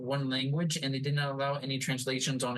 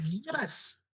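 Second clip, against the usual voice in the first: thrilled yes; 8 semitones higher than usual. Retold or imitated. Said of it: "I was like".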